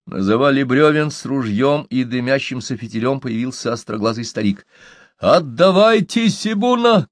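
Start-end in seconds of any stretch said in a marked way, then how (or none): 5.2-7.1 high, slow call across a distance; storyteller's raised shouting voice; shouted demand. A narrator's reading. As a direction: call out the demand, pitched well up. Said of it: "крикнул он".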